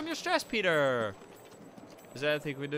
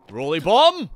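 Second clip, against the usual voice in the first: loud, high attack call; excited callout; 7 semitones higher, 15 dB louder, slower than usual. Real, not acted. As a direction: belt it out like a battle cry. Calling out a bomb attack.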